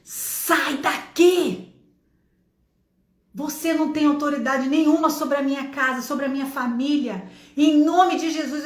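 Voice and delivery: re-enacted shout. Mother in the car rebuking the spirit of confusion, shouting. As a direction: shout a command to cast out an evil spirit. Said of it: "Eu gritei e falei assim".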